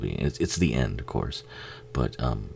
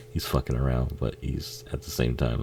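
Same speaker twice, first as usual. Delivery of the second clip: ordinary talk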